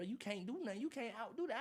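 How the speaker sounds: normal talking voice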